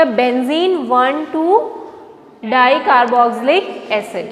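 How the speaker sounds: very slow, each syllable drawn out for notes; slow dictation. Shown as a demonstration. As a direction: spell the name out slowly for the class. Teacher dictating a compound name. (बहुत धीरे, लिखवाते हुए)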